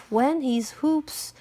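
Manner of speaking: ordinary delivery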